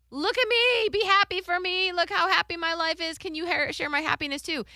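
high, loud mock gushing; needy show-off voice. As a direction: gush like an attention seeker. Mocking show-off couple posts.

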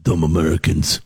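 low-pitched mocking jab at Americans; mocking foreigner. Co-host poking fun at Americans, mocking. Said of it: "to make fun of".

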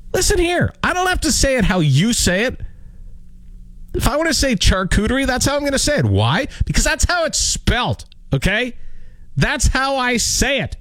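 high-pitched, worked-up self-defence; indignant rant. Real, not acted. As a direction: lay down the law in a high, indignant voice. Mock rant defending his pronunciation.

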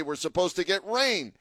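normal talking voice

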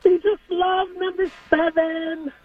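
high, giddy fangirl voice; mock swooning fan girls. Caller mimics Bengals fan girls, high and swooning. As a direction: gush in a squealy fan voice.

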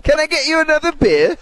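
high, put-on British accent. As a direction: ask it in a playful mock-British accent. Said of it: "talking back to him in a British accent".

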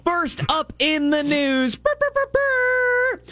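high trilled brr brr brr; mock drumroll sound. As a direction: roll your lips like a jingle. News intro jingle voice.

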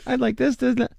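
put-on very high little kid voice; squeaky kid reeling off wishes; child voice. Kid answering mall Santa.

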